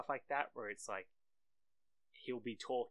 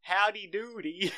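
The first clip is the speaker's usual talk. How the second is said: high, loud, slow Texan caricature; mock Texas accent; 9 semitones higher, 10 dB louder, slower than usual. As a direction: stretch it into a silly Texas holler. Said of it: "a Texas accent".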